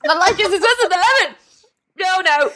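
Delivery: high, pained reaction to a sour drink; recoiling from shot. Real, not acted. Drinker reeling from the lime juice chaser, high-pitched distress. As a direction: squeal it out, reeling from the taste.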